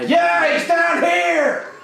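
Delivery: high, drawn-out holler; calling out. Acted. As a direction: shout it down the hallway. Calling out down the hallway.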